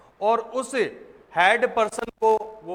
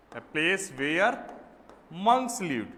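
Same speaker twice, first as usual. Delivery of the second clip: very slow, word by word; slow dictation; much slower than usual. Shown as a demonstration. Teacher repeating the definition slowly for notes. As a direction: stretch out each word to copy down. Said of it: "dictating the definition".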